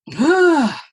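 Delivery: high, loud heartfelt sigh; emotional sigh. Real, not acted. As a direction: let out a moved sigh. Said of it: "I'm getting emotional".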